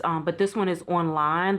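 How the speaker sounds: ordinary talk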